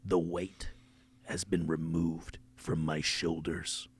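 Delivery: hushed, low, slow closing speech; mock-momentous farewell with long pauses; solemn podcast sign-off. Host thanking early supporters, hushed and solemn.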